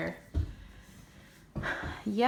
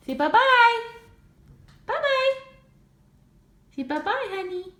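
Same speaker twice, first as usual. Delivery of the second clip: very high and louder sing-song; 12 semitones higher, 7 dB louder than usual. Real, not acted. Mom getting her son to wave bye, high baby talk.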